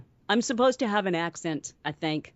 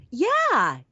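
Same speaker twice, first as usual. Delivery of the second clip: high, louder yeah; timid captive character; 9 semitones higher, 5 dB louder than usual. Acted. Prisoner answering good cop.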